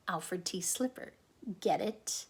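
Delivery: plain reading voice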